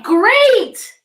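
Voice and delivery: high, loud bright exclamation; cheerful praise. Real, not acted. Teacher encouraging young readers, cheerful exclamation. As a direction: cheer the word like applause.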